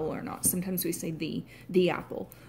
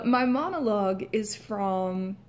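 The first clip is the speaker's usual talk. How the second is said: normal talking voice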